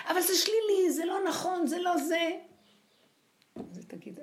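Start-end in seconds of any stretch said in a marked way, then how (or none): 0.1-2.3 much higher, louder objecting voice; mock protest. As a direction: play the critics crying out in protest. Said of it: "יגידו".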